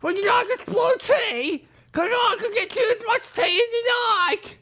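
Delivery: very high, put-on British servant offering tea; sweetly eager, squeaky host; cartoon servant voice. Shown as a demonstration. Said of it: "Available for any voiceover work".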